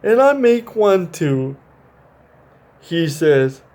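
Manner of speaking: put-on animated read-aloud for kids; reading to children. A narrator's reading. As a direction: read it like a bedtime picture book. Reads picture book aloud.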